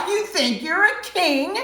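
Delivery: high, loud sneering question; mocking. Soldier taunting Jesus as king, high loud sneer. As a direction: jeer at him as if he's a fool.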